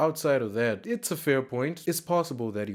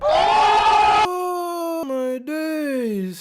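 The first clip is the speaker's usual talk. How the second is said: very high, loud, stretched-out "oh my days"; loud long stunned cry; drawn-out exclamation; 10 dB louder, much slower than usual. Real, not acted. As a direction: draw it out in disbelief. Reacting to [name] comment.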